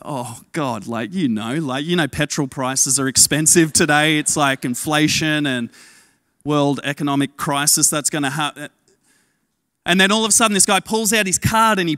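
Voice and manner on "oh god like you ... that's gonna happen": reluctant excuse. Retold or imitated. Making excuses to God.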